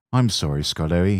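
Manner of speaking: plain reading voice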